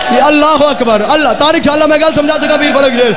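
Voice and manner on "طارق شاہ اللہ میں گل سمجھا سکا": usual speaking voice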